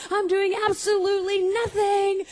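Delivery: high, anxious rant rising in pitch; railing teen. Retold or imitated. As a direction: pace and rail at the world as a panicked teen. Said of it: "And I'm railing".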